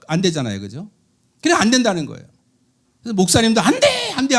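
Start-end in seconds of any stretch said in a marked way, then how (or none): 3.6-4.4 very high sharp cry of alarm; 다급한 외침. Retold or imitated. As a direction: shriek it to stop the child. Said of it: "하는 거는". (위험한 아이를 향한 아주 높은 외침)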